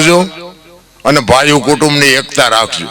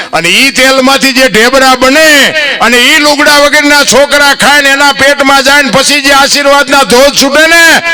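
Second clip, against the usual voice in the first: preaching at full force; 8 semitones higher than usual. Acted. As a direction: build the blessing into a soaring preacher's cry.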